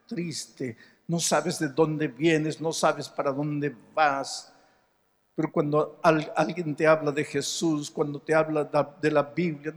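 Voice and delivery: ordinary talk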